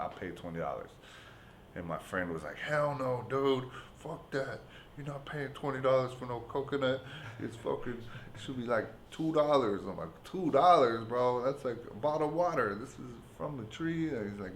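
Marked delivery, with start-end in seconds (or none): 2.6-9.8 higher-pitched imitation of the indignant buddy; mock outraged friend. Friend objecting to the twenty-dollar coconut, high and indignant. 10.2-14.1 high, loud disbelieving comeback; mock incredulous. Arguing with his friend over the coconut price, high and incredulous.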